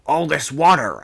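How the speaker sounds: lightly acted call from a distance; old man calling out. A narrator's reading. Overheard skipper speaking to his mate, called out. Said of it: "in the old man's voice".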